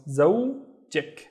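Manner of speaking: زوجك split in two, zow, jik; syllable by syllable. Shown as a demonstration. Teacher showing students the added ending, زوجك in two parts. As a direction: break زوجك slowly before its last syllable. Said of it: "add the ik at the end".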